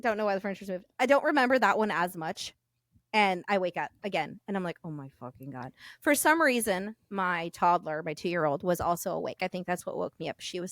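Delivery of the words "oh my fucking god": low, hushed disbelief; quiet, deflated retold reaction; retold weary dismay. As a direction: sink down very soft and low. Retold waking up again, dismayed.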